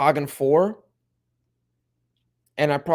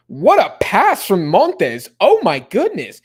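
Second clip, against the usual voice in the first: loud, drawn-out, much higher cry of amazement; amazed; 7 semitones higher, 9 dB louder, slower than usual. Real, not acted. Streamer watching the match, amazed at a pass: loud awestruck cry. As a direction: stretch every word out in awe at the pass.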